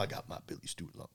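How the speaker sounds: swallowed, breathy mumble like the end of a song; mumbled lyric. Retold or imitated. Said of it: "he mumbles a bunch of stuff".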